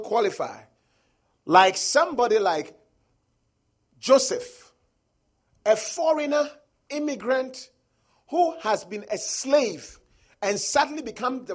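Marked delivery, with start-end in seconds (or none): none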